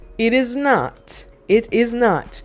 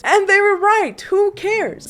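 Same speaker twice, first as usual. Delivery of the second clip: 1 emphatic high; 12 semitones higher, 4 dB louder, slower than usual. Real, not acted.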